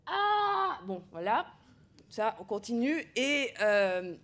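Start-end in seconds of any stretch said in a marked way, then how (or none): 0.1-0.8 long cri de douleur aigu et fort; cri de Damon. Acted. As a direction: hurler de douleur sous le couteau. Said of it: "cri de Damon". (Damon hurle pendant qu'on l'opère)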